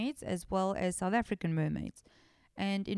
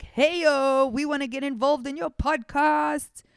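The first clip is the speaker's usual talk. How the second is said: loud, brash, lightly voiced spirits; the mer people butting in, a bit hammed; a hint of rowdy water spirits; 5 semitones higher, 7 dB louder than usual. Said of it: "they're like".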